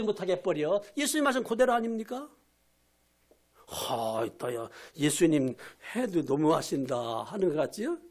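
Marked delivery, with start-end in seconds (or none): 3.7-7.2 much lower, slow disgruntled grumble; grumbling listener. Pretend. Preacher voicing a listener grumbling that Jesus goes too far, low and slow. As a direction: mutter a complaint low and slow.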